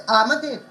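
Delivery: "Amadev" with the opening A punched; stress on first syllable. Shown as a demonstration. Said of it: "each syllable has its own stress".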